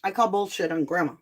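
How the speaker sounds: usual speaking voice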